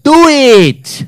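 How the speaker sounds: very high, loud goading yell; defiant dare. Acted. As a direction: shriek it as the beaten rival daring the winner. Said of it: "through this toothless grin and said".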